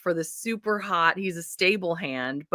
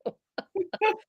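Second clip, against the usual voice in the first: laughing; 7 semitones higher, much slower than usual. Real, not acted. Laughing fit between words.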